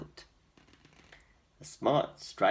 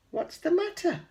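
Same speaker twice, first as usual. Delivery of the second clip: much higher, louder caring question; kind giant asking; 7 dB louder than usual. A narrator's reading. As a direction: ask the goat what's wrong. George asks the goat.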